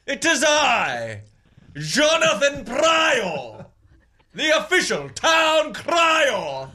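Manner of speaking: slow, rolling British-style proclamation; fancy yourself up like an English crier; British town crier. Pretend. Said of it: "Why are you sounding British?".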